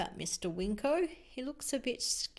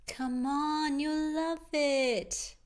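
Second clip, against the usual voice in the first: high, slow coaxing voice; 6 semitones higher, 4 dB louder, slower than usual. Acted. Witch Meg coaxing Owl toward school, slow and high. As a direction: coax a nervous pupil along gently. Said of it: "Meg's trying to coax Al".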